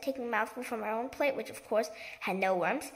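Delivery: ordinary delivery, nothing marked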